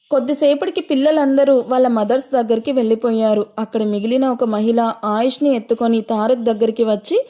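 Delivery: ordinary delivery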